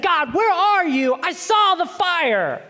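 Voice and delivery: high, loud acted plea; desperate calling out. Elijah calls to God from the cave, desperate cry. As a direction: cry out to an absent God, stretching it.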